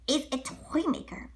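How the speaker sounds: ordinary delivery, nothing marked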